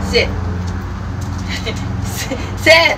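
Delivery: talking to a dog. Real, not acted. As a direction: coo and give commands like to a puppy. Owner coaxing her dog.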